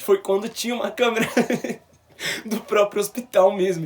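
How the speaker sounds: laughing through the words. Real, not acted. Vlogger recounting the hospital camera, laughing. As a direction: let the laugh break into the telling.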